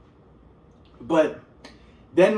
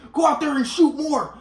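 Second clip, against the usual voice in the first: higher, slow commanding dad; ordering dad; 9 semitones higher than usual. Retold or imitated. Son imitates dad sending him back outside, higher and slow. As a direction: bark it like an impatient father.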